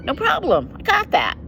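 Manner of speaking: voicing Peter. Peter to Jesus, brushing the question off, high and eager. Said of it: "Peter's like".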